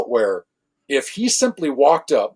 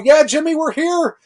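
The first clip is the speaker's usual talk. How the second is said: high, loud, taunting yell; joining the role player's scream as a joke; mocking yell; 5 dB louder than usual. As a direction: egg Jimmy on, mocking her panic. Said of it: "I'm yelling shoulder to shoulder with her".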